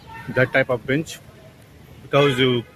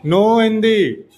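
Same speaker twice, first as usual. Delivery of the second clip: slow, louder, higher No Hindi; firm slow command; 5 semitones higher, 5 dB louder, slower than usual. Real, not acted. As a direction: lay down the rule slowly.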